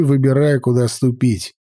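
ordinary delivery

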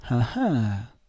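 mildly mocking 'ah ah' of fake surprise; feigned amazement. A narrator's reading. Man feigns wonder at the boy's objection, mock amazement. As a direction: act astonished, drawing out the exclamation. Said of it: "con finto stupore".

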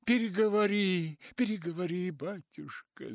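high, loud shout fading off; shouting from the crowd. A narrator's reading. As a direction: call out from the crowd. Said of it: "закричали ему из толпы".

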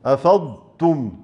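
أفضتم with a distinct ض before ت; slow model recitation of أفضتم; slow, clear dad. Shown as a demonstration. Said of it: "بيان الضاد عند التاء".

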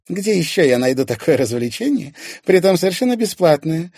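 high, loud sarcastic woman; smirking. A narrator's reading. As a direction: say it with a mocking smirk. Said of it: "ухмыльнулась Ирина".

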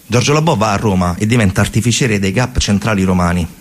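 ordinary talk

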